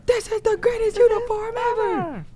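very high, gushing cartoon squeal; Pearl. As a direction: shriek it out like a thrilled cartoon character. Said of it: "Well, Pearl".

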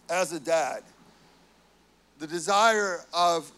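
normal talking voice

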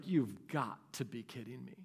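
quiet weary disbelief; muttered disbelief. Retold or imitated. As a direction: groan it under your breath in disbelief. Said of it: "I'm just like".

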